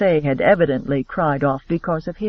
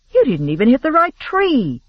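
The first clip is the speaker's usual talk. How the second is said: higher, louder, genuinely surprised; 8 semitones higher, 4 dB louder than usual. Acted. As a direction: blurt it out, caught off guard. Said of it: "Elizabeth said in surprise".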